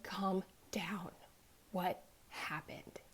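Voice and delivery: slow, whispery, controlled reassurance; hushed calming. Retold or imitated. Mother telling her crying daughter to calm down, hushed.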